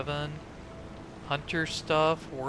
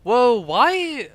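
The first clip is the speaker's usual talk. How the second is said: startled whoa; 6 semitones higher, 11 dB louder than usual. Real, not acted. Startled by hostile crow.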